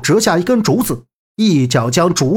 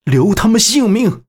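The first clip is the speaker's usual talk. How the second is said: urgent call; 6 semitones higher than usual. Acted. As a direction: call out to stop the blows. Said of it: "十号大喊". (高声大喊)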